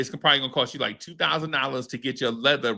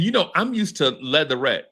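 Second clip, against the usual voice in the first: ordinary talk, nothing marked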